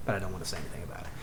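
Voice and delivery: low, soft, quick undertone; imitated quiet, rushed muttering; withheld anger. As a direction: voice mom's unspoken grudge under your breath. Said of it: "in the tone of voice that would mean".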